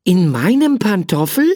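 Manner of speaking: man asking the creature about his own slipper, lightly incredulous; mildly unbelieving. Acted. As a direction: ask as if you can't quite believe it. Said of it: "fragte Hermann Muffert ungläubig".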